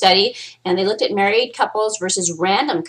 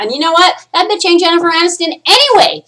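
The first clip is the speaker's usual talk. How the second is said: loud, high-pitched acted rant; hammed-up exasperated outburst; mock indignation; 10 semitones higher, 8 dB louder than usual. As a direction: protest it like a fed-up boyfriend. Acts guys' indignant reaction to movies.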